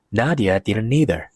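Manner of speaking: plain reading voice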